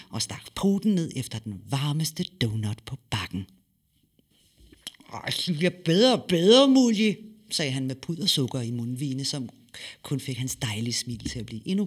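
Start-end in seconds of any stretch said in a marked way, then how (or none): 5.1-7.2 put-on higher, louder boy's voice savouring; munch and praise happily; delighted boy. Son enjoys donut, voiced. (drengen roser donuten begejstret og højt)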